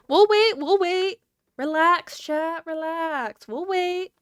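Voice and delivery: high-pitched mock soothing, words stretched out; playful hushing of chat. Streamer telling impatient chat to relax, high playful soothing.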